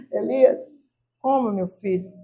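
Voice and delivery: voiced angel, soft and low; gentle waking voice. Angel waking Elias to eat, gentle and soft. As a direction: softly coax a sleeper to eat.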